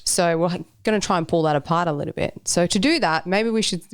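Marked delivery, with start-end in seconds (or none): none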